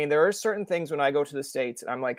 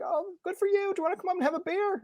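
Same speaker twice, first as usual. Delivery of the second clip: very high, softer, hammed-up niceness; friendly Canadian stranger; 5 dB quieter than usual. Canadian stranger offering a beer, mock friendly. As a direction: invite them in a high sweet voice.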